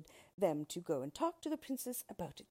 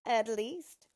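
loud, malicious dwarf; acted raised-voice spite; spiteful; 4 semitones higher, 8 dB louder than usual. A narrator's reading. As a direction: gloat at the princess. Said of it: "began to laugh spitefully".

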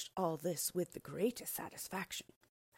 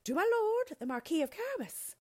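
very high, loud singsong call; chorus it out high and loud, like a drilled group; obedient chorus; 14 semitones higher, 7 dB louder than usual. A narrator's reading. Reapers answer the king as told, high obedient chorus. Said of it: "they chorused obediently".

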